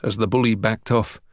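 usual speaking voice

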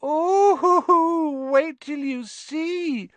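a delighted ghost relishing bad news; gleeful ghost. Myrtle gloats to Harry and Ron over Hermione, high and slow.

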